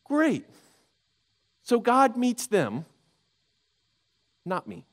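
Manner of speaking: mock the luck of others, then sink; sharper bitter sarcasm. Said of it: "he's like".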